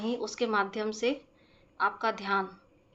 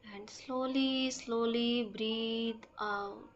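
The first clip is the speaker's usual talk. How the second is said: very slow, measured meditation voice with stretched-out words and long pauses; guided breathing meditation; much slower than usual. Acted. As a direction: guide them slowly through each breath. (धीमी, शांत ध्यान कराने वाली आवाज़)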